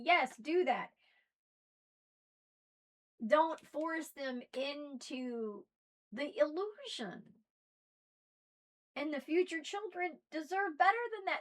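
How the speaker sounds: usual speaking voice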